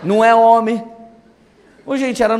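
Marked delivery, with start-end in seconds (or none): 0.0-0.8 louder, emphatic mock scolding; insistent raised voice, acted out; retold yelling. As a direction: imitate a wife hammering the accusation. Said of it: "quando ela tava gritando".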